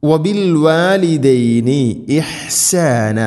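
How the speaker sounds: high, slow tajweed chant; Quranic recitation. Shown as a demonstration. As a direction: chant it slowly with drawn-out vowels. Quran teacher models the recitation.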